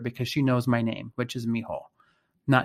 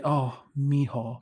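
a lightly voiced, slow, tender "Oh, Mijo"; tender mother; 3 dB quieter, slower than usual. Mother comforting her son when he needs love, lightly tender. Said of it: "She would say".

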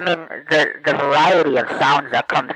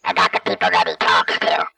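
very deep electronic alien voice; alien voice changer; 12 semitones lower than usual. Acted. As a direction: speak through a deep voice changer. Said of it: "I sound like a friggin' alien".

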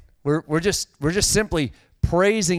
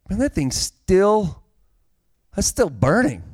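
drawn-out, puzzled double take; lightly acted wonder, stretched out; mild amazement; 5 semitones higher than usual. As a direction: act out an onlooker's growing disbelief. Acts out onlooker puzzled by bush.

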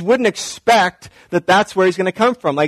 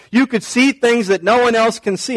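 normal talking voice

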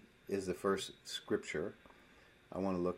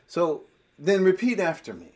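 higher, louder mock instruction; acted soul-winner; 7 semitones higher, 8 dB louder than usual. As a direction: play an eager preacher giving the prompt. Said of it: "you don't tell somebody".

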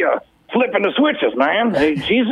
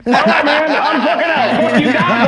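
mock angry exit; 6 semitones higher, 4 dB louder than usual. Mock storming off the call.

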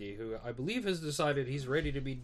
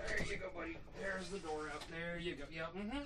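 much softer, as if away from the mic; aside to the cat; 3 semitones higher, 9 dB quieter than usual. Real, not acted. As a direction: murmur soothingly to a pet.

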